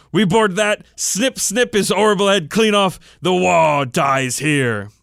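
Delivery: grim battle vow, drawn out at the end; gruff pack leader, vowing. Acted.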